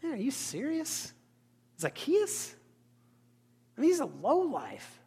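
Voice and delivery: high, scornful mock grumble; grumbling onlooker. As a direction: scoff at the tax collector in disbelief. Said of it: "there's a piece of me that would be like".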